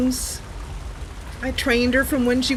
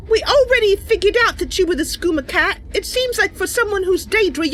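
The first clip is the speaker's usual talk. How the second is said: a hint of an indignant heroine, slightly higher; lightly voiced heroine; 12 semitones higher than usual. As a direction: reproach the Daedra lightly, then soften. Role-plays confronting the cat.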